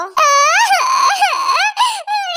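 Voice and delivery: hammed-up high sobbing wail. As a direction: burst into a high bawling cry. Girl hit by car wails.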